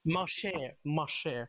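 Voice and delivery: "Ma chère" said the French way, mah shair; French pronunciation. Shown as a demonstration. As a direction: teach "Ma chère" slowly. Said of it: "Not mon Dieu".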